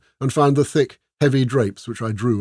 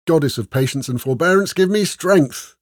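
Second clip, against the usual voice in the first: exasperated plea; 6 semitones higher, 4 dB louder than usual. A narrator's reading. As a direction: beg for strength while fighting your temper. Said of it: "trying to control her temper".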